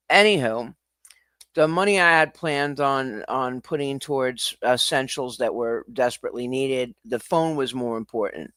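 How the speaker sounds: ordinary talk, nothing marked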